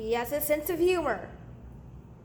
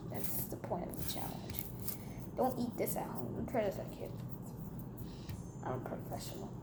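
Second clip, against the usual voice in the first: soft, half-whispered aside to camera; hushed aside; 2 semitones lower, 10 dB quieter than usual. Acted. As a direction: lean in and murmur it.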